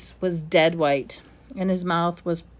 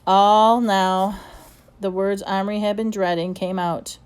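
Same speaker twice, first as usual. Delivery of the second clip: ordinary delivery, nothing marked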